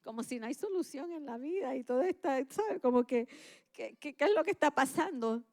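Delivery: a hammed-up teary lament; mock weepy whine. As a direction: whimper it like a self-pitying cry. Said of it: "yo empiezo a llorar".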